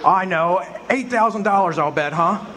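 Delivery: ordinary delivery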